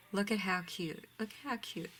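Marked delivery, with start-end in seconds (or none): 1.2-1.9 higher and softer, cooing. Real, not acted. Cooing at the bunnies to her viewers, higher and softer. Cooing over bunny ribbon.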